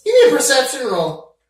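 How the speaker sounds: loud high command. Real, not acted. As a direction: call it out loud. Game master demands roll.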